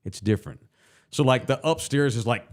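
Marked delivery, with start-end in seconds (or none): none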